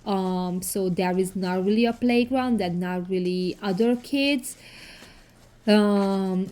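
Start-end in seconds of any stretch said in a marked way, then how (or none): none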